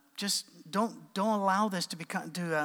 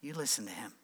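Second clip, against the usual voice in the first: quiet echo of God's command; 6 semitones lower, 7 dB quieter, faster than usual. Retold or imitated. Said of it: "He goes".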